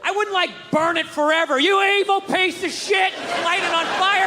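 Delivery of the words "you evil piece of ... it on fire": hammed-up rage at a broken machine; cursing out a car that won't run; mock furious yell.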